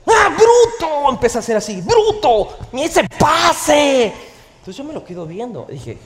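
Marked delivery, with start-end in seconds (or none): 0.1-4.1 high, loud, slow yelling at the TV; hammed-up football fan yelling; excited fan shout. Son cheering a Champions match on TV, loud and high.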